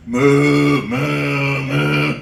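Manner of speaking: low mooing cow. Retold or imitated. Comic imitating his farm cows with low moos.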